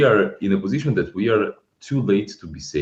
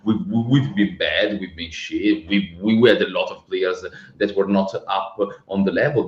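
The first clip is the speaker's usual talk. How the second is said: usual speaking voice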